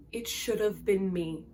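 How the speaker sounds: slow and soft, a hollow admission of guilt; quiet broken confession. Retold or imitated. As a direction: let the line sink, softly and slowly. Feyre's broken confession, quoted.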